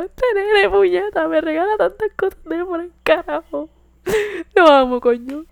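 high, loud wailing sob-talk; mock crying. Crying over her boyfriend's gift, hammed-up wailing. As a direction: blubber it, overwhelmed with love. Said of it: "Y yo estaba llorando".

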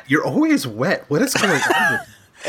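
pet talk voice. Real, not acted. Talking to the cat on screen.

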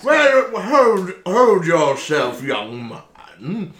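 put-on grandiose old-fashioned gentleman; pompous gentleman. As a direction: scold the lad with airs. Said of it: "Why does Arson not talk like this".

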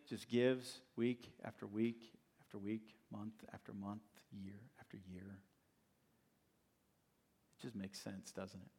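trailing down into a soft whisper; fading to a whisper. Acted. Preacher to congregation about steady givers, fading to a whisper.

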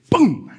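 high-pitched boom, falling away; imitated boom. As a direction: punch out a boom for the lift. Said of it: "And he'd go".

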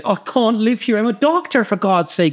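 high-pitched Belfast voice in mock distress; hammed-up Belfast accent, distraught; tearful Belfast impression. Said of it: "He was from Belfast. It's a good impression".

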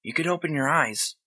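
lightly voiced boy, reassuring. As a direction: gently assure him as the kid. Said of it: "the boy's voice assured him".

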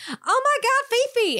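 high frantic cry after a runaway dog; panicked squeal. Acted. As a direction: squeal the dog's name in a panic. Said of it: "she's like".

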